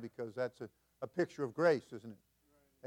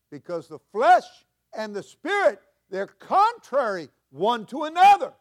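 higher and louder, rising preacher cadence; preaching at full force; 8 semitones higher, 14 dB louder than usual. Real, not acted. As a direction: lift the congregation with fervor.

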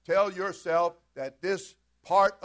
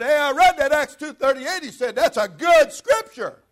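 calling out loud from a car window; man yelling happily from his car; retold yell; 10 semitones higher, 14 dB louder than usual. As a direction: shout it across the road, pleased. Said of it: "he yelled".

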